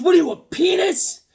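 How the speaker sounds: high, loud jeering comeback; mock retort. Retelling his comeback to Bobby. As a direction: fire it back high and loud. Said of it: "I said".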